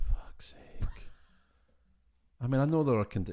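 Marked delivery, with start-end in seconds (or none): 0.1-0.9 whispered curse. Real, not acted. Exasperated aside after plot point.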